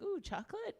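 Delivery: high-pitched, teasing eager question; mock eager interest. Comic imitates an audience member's interest, mock eager. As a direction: perk up high, as if offered a treat. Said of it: "He's like".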